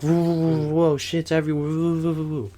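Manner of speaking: high, wobbling run of whoas; violent trippy rush. Retold or imitated. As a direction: act out being swept up by a wild trip. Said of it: "it was very, like, violent".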